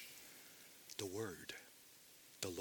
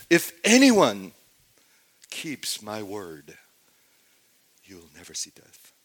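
tender promise; 3 semitones lower, 7 dB louder than usual. Shown as a demonstration. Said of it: "What is the tone of voice of this statement".

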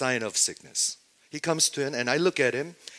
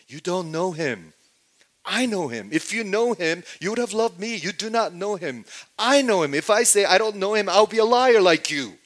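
raised, insistent voice of accusation; forceful confrontation; 8 semitones higher, 7 dB louder than usual. Acted. Preacher voices Jesus challenging those who don't know him, forceful rebuke. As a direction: build in pitch and volume as you rebuke.

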